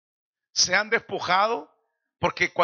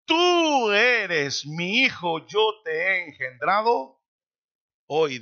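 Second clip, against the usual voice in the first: very slow, tú hit firmly; slow solemn proclamation; much slower than usual. Acted. Preacher voicing the Father to the Son, slow and solemn. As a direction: speak it as the Father declaring a son.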